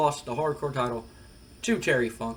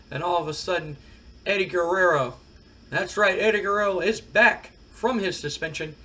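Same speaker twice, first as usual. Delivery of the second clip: ordinary talk, nothing marked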